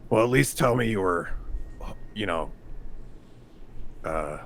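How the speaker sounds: usual speaking voice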